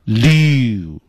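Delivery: "W" only as its last part, a long lyoo; isolated final syllable of "W"; ending on U. Shown as a demonstration. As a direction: draw out the oo ending of "W". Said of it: "最后还是落在一个元音U上".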